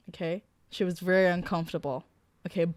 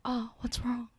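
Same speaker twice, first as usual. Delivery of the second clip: imitating the guy; 6 semitones higher, 4 dB quieter than usual. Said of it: "the way you said that too".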